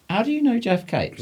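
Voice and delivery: high-pitched, baffled retelling of the question; surprised question. Retold or imitated. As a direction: ask it like you can't believe he knows him. Retelling his own surprise.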